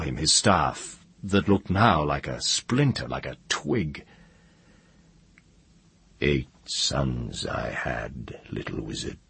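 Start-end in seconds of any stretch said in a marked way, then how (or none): 6.2-9.2 low, slow, soft dragon; dry, deep, quiet dragon speech; great dry dragon voice. A narrator's reading. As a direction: speak as an ancient dragon, dry and unhurried. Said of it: "said the great dry voice of the dragon".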